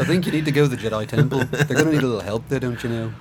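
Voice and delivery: lightly mocked Liverpudlian lilt; quick, playful Liverpool impression. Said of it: "make him talk like the Beatles".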